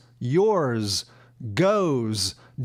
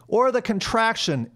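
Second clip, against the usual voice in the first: usual speaking voice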